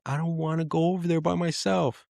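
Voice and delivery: put-on high, whiny kid voice; scared little boy. Dad imitating his scared son's high whine. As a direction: imitate a frightened four-year-old. Four-year-old son scared of hallway.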